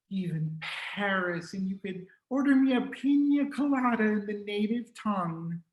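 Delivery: high, exasperated motherly voice; mother pestering her son about his studies, high and nagging. Acted. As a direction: whine and dream about Paris. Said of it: "She would ask".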